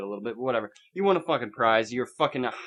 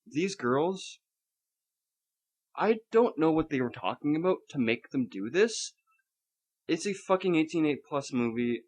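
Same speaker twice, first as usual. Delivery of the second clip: normal talking voice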